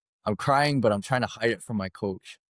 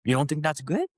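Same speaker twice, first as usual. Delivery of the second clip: higher, incredulous coach voice; imitating coach; 7 semitones higher than usual. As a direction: challenge him like a disbelieving coach. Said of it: "And he goes".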